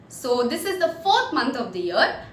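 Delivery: normal talking voice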